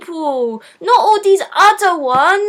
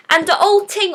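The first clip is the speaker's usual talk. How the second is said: ordinary delivery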